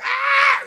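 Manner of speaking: high, loud mock scream of a startled girl; imitated scream. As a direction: shriek like a girl waking to a monster.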